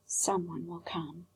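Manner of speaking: a faint echo in his mind; echoing softly. A narrator's reading. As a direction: let it drift back faintly. Echoed words, very soft.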